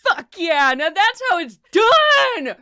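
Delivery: shrieking high victory yell; triumphant cheer. Acted. Bounty hunter Rook yelling in triumph after the kill. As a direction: crow over the kill at the top of your lungs. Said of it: "And Rook is like".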